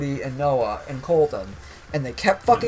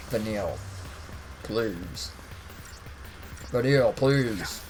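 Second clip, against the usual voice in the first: put-on voice; 6 semitones lower, 8 dB quieter, slower than usual. Odd put-on voice.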